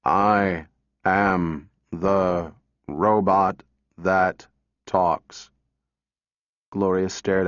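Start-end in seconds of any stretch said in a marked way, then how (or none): 0.0-5.5 low, very slow, toneless machine voice; flat robot voice. A narrator's reading. Robot answering a little girl, flat and mechanical. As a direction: drone it out like a talking machine. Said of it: "that lacked accent and intonation".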